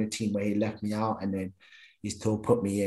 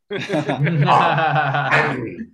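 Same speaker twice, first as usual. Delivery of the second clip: laughing; 5 dB louder, much slower than usual. Real, not acted. Laughing while listing.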